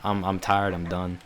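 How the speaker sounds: low, quiet resignation; fed up. Retold or imitated. Speaker recalling being done with the friendship, fed up. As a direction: give up wearily, dropping pitch and volume. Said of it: "I got fed up".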